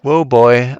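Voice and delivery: loud startled whoa; jolted exclamation; surprised. Real, not acted. Startled by enemy.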